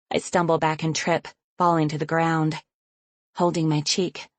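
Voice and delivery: usual speaking voice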